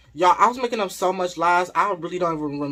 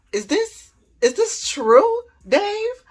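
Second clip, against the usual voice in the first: high-pitched, stunned wife questioning; shocked; 7 dB louder, slower than usual. Retold or imitated. Shocked wife asking her husband whether it is true, high-pitched disbelief. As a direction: imitate shocked disbelief, voice climbing right up. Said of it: "she was shocked".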